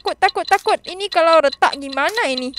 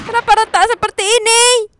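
ordinary delivery

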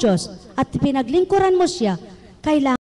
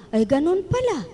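normal talking voice

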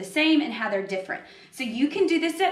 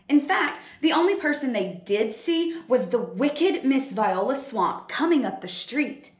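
usual speaking voice

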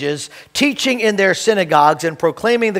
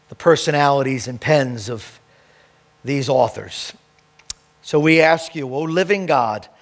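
lower, earnest prayer voice; 4 semitones lower than usual. Acted. Pastor leading the congregation in prayer before the sermon, earnest.

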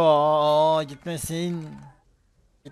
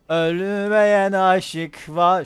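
dramatic declamation; 6 semitones higher, 7 dB louder, much slower than usual. Pretend. Dramatic line.

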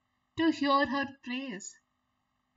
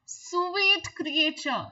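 slow, higher, honeyed sweet creature; flattering spider; 6 semitones higher, 3 dB louder, much slower than usual. Acted. Spider flattering the fly, slow and higher. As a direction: draw it out to flatter. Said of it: "said the spider".

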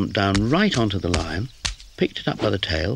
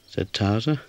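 plain reading voice

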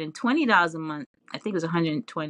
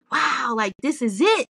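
recalling the thrill, a touch higher and brighter; exhilarated; 7 semitones higher, 4 dB louder than usual. Retold or imitated. New dancer realizing it's her path, mildly excited. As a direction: relive the moment it all clicked, lightly excited. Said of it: "I felt exhilarated".

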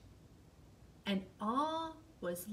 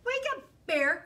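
loud, high wake-up call; high, loud wake-up cry; cried out; 12 semitones higher, 6 dB louder than usual. Acted. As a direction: shout him awake. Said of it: "cried Fox".

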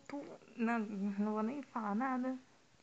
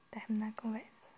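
voiceless whisper; quiet whispered aside; whispered menu choice; 2 semitones lower, 6 dB quieter than usual. Real, not acted. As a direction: read the option under your breath. Reads menu choice under breath.

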